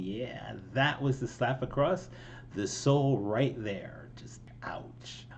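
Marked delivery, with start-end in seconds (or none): 4.2-5.3 a soft breathy whisper of pain; whispered wince; whispered ouch. Real, not acted. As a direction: breathe it out under your voice. Reacting to painful lyric.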